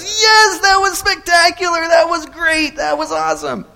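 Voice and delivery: high, loud raving, tapering off; cheering crowd. Acted. Crowd after the wheelbarrow crossing, high excited cheering. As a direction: rave like thrilled spectators. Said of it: "and the crowd goes".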